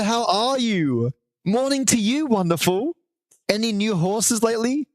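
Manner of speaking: high, drawn-out cheery pilot voice. Pretend. Streamer greets chat friend Mari in a put-on pilot voice. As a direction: greet like a beaming airline pilot. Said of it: "back to my wonderful, uh, pilot voice".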